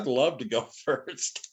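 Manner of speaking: slow, amused, chuckling mid-line; laughing. Retold or imitated. Stanton graciously giving up his slot, laughing. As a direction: let a laugh slip in as you graciously accept.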